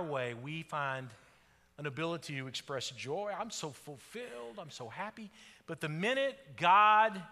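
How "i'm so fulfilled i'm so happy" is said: mock contentment. Preacher mimicking believers when things go their way, softly smug. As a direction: coo it, self-satisfied.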